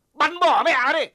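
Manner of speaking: enraged officer yelling at his men; furious command. Acted. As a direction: bark it at the militia. Officer orders to shoot.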